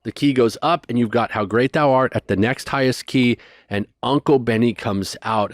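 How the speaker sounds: ordinary talk, nothing marked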